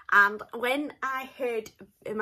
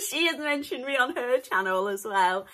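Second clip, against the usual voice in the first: giddy laugh, then words still laughing; giggling; 2 semitones higher, 4 dB louder than usual. Real, not acted. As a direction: laugh through it, delighted.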